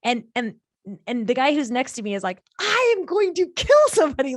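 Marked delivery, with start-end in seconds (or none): 2.6-4.4 high, loud outburst easing off; acted outrage. Business partner fuming over the man's mistake, acted outrage. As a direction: explode in mock exasperation, then settle.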